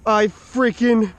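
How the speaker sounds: high, loud, slow I freaking got him; real victory cry; triumphant shout. Real, not acted. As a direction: jubilant yell over the catch. Lands the big trout.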